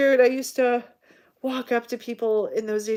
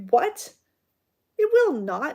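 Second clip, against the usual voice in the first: high, louder startled protest; indignant disbelief; 7 semitones higher, 5 dB louder, slower than usual. Retold or imitated. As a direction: protest the insult. Boyfriend's indignant retort.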